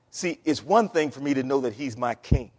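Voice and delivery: normal talking voice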